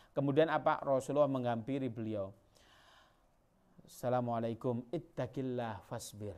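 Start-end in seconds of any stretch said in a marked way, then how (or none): 3.8-6.3 gentle prophet voice. Acted. Rasulullah speaks gently to grieving woman.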